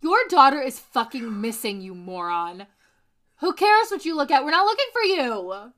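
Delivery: loud, exasperated telling-off; mock scolding. Host berating Casey, loud and fed up. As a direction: yell it like a fed-up parent.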